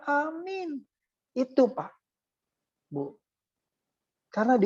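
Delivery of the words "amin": high, drawn-out amen; acted wife chiming in high; wife's amen. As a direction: answer the prayer with a lifted, stretched amen. Acting wife's amen to prayer.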